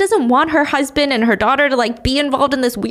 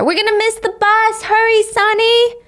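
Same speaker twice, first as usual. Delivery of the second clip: mother calling her daughter to the bus; 11 semitones higher than usual. Acted. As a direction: call her to hurry. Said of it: "Sani's mom calls for her".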